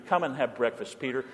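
Jesus softly calling Peter to breakfast; gentle invitation. Acted. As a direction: invite him in quietly, kindly.

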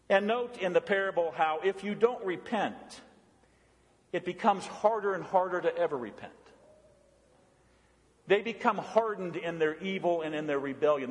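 ordinary delivery

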